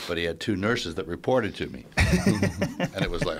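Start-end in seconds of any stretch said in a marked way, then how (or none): 1.9-2.9 high, loud burst of laughter; real laugh that breaks out high. Real, not acted. Laughing at embarrassing detail.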